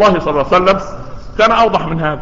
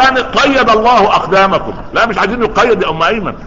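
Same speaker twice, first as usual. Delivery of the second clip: normal talking voice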